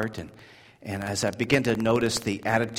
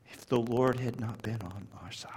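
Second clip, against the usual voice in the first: whisper; 8 dB quieter than usual. Real, not acted. Hushed line amid loud preaching.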